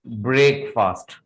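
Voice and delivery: "ब्रेकफास्ट" as "brake-faast"; two full words "brake" and "fast" in "ब्रेकफास्ट"; common mispronunciation. Shown as a demonstration. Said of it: "This is not the right pronunciation". ("ब्रेकफास्ट" में पूरा "ब्रेक" और "फास्ट")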